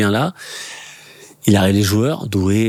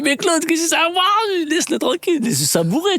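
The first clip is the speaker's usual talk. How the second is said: pleading. Retold or imitated. Pleading with Makelele to stop.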